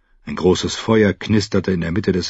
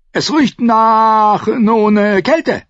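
put-on old man without his teeth; toothless; 6 dB louder, much slower than usual. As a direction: speak slowly with no teeth. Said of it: "Er hatte seine Zähne herausgenommen".

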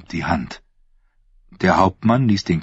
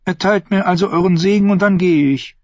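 put-on young woman's voice set higher than the narration, sweetly scheming; Thyene's voice; 10 semitones higher than usual. Thyene to her uncle the prince, sweetly scheming. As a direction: speak as the sly, innocent-sounding niece.

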